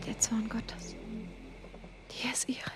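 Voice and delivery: breathless awed whisper, halting; awed stammer. Acted. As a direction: stammer it in holy fear, whispered. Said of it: "stammelte er ehrfürchtig".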